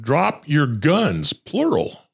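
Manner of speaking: hammed-up order to drop guns; mock command; imitating a barked order. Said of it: "he said".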